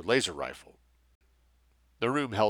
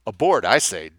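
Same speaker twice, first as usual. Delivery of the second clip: loud sudden blurt; a nervous man blurting his refusal loudly; 8 dB louder than usual. Acted. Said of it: "Doe blurted".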